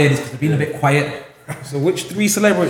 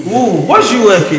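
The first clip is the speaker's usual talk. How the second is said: teasing; 3 semitones higher, 10 dB louder, slower than usual. Real, not acted. Teasing a loaded question.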